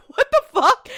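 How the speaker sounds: high, loud, laughing outburst; laughing disbelief. Acted. Viewer reacts to absurd gag, laughing disbelief. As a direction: react in mock outrage through laughter.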